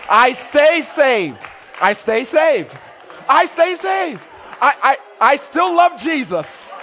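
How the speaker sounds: loud, building declaration; shouted testimony. Acted.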